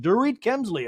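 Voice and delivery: high announcer-style name reveal. Retold or imitated. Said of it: "And the M C's like".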